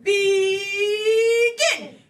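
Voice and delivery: very slow, rising game-host kickoff; drawn-out call. Real, not acted. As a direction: hold each word long and loud before the start. Host launches the round, calling out.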